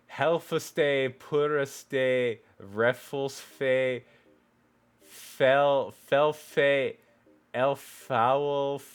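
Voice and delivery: loud, slow sounding-out of "Helfaste, puraste, refulsfe, felfe, elfaulfeofe"; reading gibberish. Shown as a demonstration. Reader stumbling aloud through keyboard-mash text, sounding out each syllable. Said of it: "someone's just, like, mashing their keyboard".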